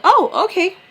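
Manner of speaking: high, loud mock-friendly chirp; suddenly agreeable. Man agreeing to the pickup time, imitated friendliness. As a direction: imitate an over-nice change of tune. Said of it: "this is not how he was acting".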